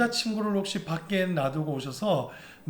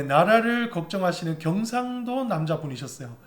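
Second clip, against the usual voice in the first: ordinary talk, nothing marked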